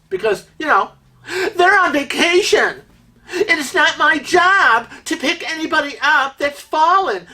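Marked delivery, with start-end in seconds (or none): 1.5-7.3 high, whiny mock voice of an entitled passenger; high mocking whine of someone who won't help; mocking the careless passengers. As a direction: sneer it out like a selfish tourist.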